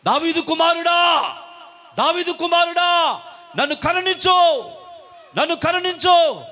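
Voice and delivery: high, loud desperate cry for mercy. Acted. Blind beggar calling to Jesus on the road, shouting. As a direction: shout it out to someone passing by. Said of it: "అని కేకలు వేశాడు".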